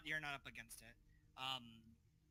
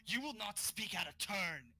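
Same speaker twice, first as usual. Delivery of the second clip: louder, higher, slow commanding outburst; acted yell; 5 semitones higher, 5 dB louder, slower than usual. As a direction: cut them off with a drawn-out, raised-voice rebuke. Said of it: "and just yells out".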